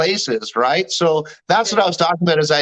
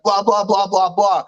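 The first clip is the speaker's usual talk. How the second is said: mock babble of an aggressor; man in her face. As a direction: rattle off blahs imitating someone ranting. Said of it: "started getting in my face".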